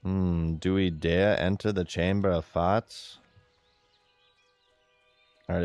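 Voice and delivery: ordinary talk, nothing marked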